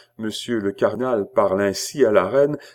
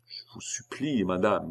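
whispered, breath-only entreaty; hushed plea; 9 dB quieter than usual. A narrator's reading. Cardinal begs the queen to stop, whispering. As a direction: beg under your breath.

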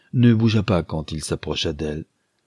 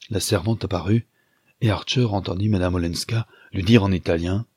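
plain reading voice

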